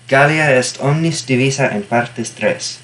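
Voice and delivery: Latin read slowly in a Spanish accent. Shown as a demonstration. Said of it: "I said that with a Spanish accent".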